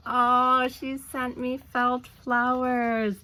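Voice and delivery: real joy at finding felt flowers; delighted gasp. Real, not acted.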